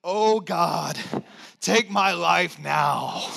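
a hint of a despairing plea, slow; preacher voicing Elijah's weary plea; despairing prophet. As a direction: drag out a mock lament. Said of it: "he says it this way".

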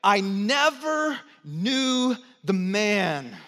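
slow, deliberate denial, lightly voiced Peter. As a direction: drag out "I never knew the man" as Peter. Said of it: "he said it this way".

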